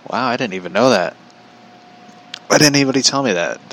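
real surprise at news of Bumblebee; surprised. Real, not acted. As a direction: caught off guard by the news, voice jumps up. Learns of Bumblebee on Titans.

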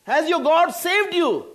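high, loud desperate call without restraint; the king abandons royal manner to cry to Daniel; anguished cry. Retold or imitated. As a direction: throw all caution away and cry out. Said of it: "he threw all the caution and all the upbringing".

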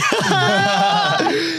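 high, drawn-out mocking sorry. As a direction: sing it mockingly. Mocking quoted text.

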